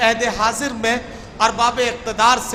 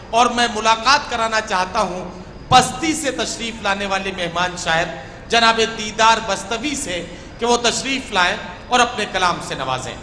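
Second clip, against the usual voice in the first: normal talking voice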